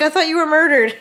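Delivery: retelling her own frantic reply to her husband; exasperated outburst. Retold or imitated. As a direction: blurt it out in disbelief. Her retort to husband, retold.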